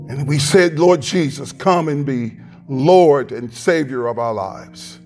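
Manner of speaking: ordinary talk, nothing marked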